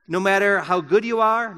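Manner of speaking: ordinary delivery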